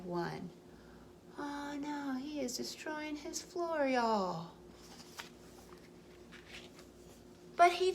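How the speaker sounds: gentle storybook read-aloud; reading to children. A narrator's reading. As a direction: tell it like a bedtime story for little ones. Reading picture book aloud.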